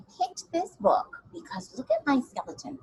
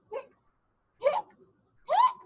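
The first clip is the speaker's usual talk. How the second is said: hiccups; 4 dB louder than usual. Pretend. Imitating skeleton's hiccups.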